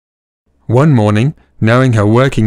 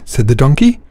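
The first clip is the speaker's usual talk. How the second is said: ordinary delivery, nothing marked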